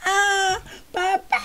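high crying scream for dad; imitated child scream. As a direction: wail like a kid being hit. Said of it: "Y suena de repente". (un niño gritando a su papá)

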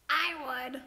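high, creaky little coral. A narrator's reading. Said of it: "creaked a crusty coral".

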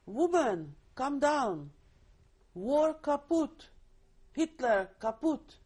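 high, loud shouted order to come down; retold hollering. Laborer hollering up into the barn that the war is over, a retold loud call. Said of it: "started hollering".

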